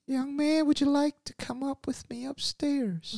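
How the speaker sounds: put-on very high, hushed, whispery old lady invitation; grandma luring him upstairs in a high whisper; creepy grandma. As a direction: make the granny sound eerie and coaxing. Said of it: "she was like".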